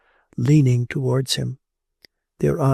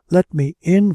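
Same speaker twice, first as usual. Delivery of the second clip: raised, higher-pitched entreaty; urgent plea; 5 semitones higher, 7 dB louder than usual. A narrator's reading. Woman at the window begs the monk, urgent raised plea. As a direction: call out to be let inside.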